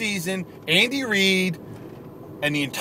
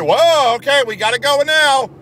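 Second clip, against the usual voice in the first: mock fan; 8 semitones higher, 4 dB louder than usual. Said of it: "Would you have somehow said".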